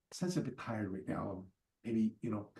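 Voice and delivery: soft, low, tailing off; hushed excuse. Retold or imitated. Go-between turning the caller away, acted hushed excuse. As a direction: let the excuse down gently under your breath. Softening refusal to visitor at door.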